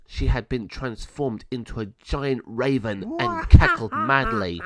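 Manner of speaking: usual speaking voice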